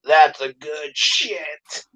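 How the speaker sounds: slow, drawn-out put-on voice; imitated reply. Tourette's guy answering the janitor, slow put-on reply. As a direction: mimic him, stretching the words out. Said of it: "And he goes".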